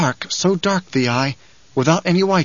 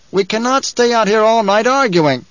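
high-pitched, raised protest; impatient objector; 8 semitones higher, 4 dB louder than usual. Acted. Man in the rocks tires of arguing, impatient. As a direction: complain down to your leader impatiently.

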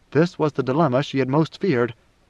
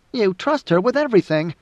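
high, squeaky protest; Topri; 6 semitones higher than usual. A narrator's reading. Topri protesting to Siona about her trust, squeaky. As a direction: pitch it up into an indignant squeak. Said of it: "Topri squeaked when he spoke".

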